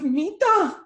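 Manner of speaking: high, loud cry of joy; overjoyed. Retold or imitated. Dying mother calling to her daughter, overjoyed cry. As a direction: call it out, overcome with happiness. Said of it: "she's so happy she's going home".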